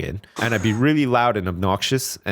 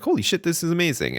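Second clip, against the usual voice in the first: amazed; 7 semitones higher than usual. Pretend. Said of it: "I'd be like".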